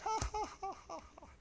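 high, fading "ah, ha, ha" mimicking the driver; imitated mocking laugh. Driver laughing at the man who prayed, imitated high laugh. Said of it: "And he just laughed".

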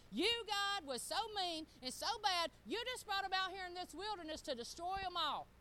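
high, loud mock accusation; accusing onlookers. Preacher voices Egyptians blaming God, loud and accusing. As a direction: hurl the blame, imitating scornful outsiders.

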